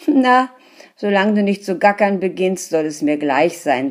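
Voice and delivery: loud, quick dismissive scolding; scolding wife. A narrator's reading. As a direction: brush off her husband's nonsense.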